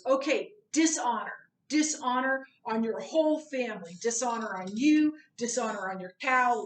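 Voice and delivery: loud, indignant mock rant; hammed-up angry dragon yelling dishonor; angry Mushu. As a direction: shout it like a furious little cartoon dragon. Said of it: "Mushu gets angry and says".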